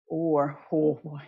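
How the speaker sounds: normal talking voice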